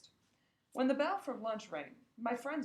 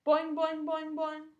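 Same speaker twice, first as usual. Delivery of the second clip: high, loud twangy boings; agitated springy twangs; frog boings; 7 semitones higher, 8 dB louder, slower than usual. Acted. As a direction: bounce each boing like an upset frog. Frog Og answering upset.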